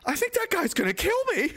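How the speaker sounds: very high mock-frightened voice through laughter; mock-scared woman. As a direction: pitch it way up, panicked woman, half laughing.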